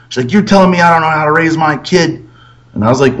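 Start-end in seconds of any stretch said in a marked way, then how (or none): 0.3-2.3 higher-pitched indignant retort, imitated; mad girlfriend. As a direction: mimic an offended mother challenging you. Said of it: "that was the most mad she ever got".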